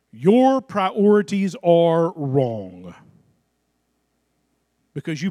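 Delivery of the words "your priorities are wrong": slow, loud and deliberate: your priorities are wrong; slow, weighty preacher's rebuke; slow firm rebuke. Real, not acted. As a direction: drive each word home.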